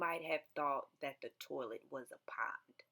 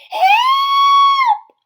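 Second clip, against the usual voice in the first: very high, very loud scream for help; shouting; 24 dB louder than usual. Acted. Missus Blair screaming for help at the flood. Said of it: "shouted Missus Blair".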